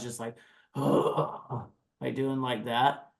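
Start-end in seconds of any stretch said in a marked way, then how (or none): 0.0-2.1 false chord sigh demo. Shown as a demonstration. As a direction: let out a gritty rumbling sigh. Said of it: "when you let out one of those sighs".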